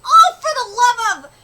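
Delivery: loud, high cry of frustration; exasperated outburst. Acted. Elf scolding the berserk girl, loud and exasperated. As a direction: throw your hands up, loudly.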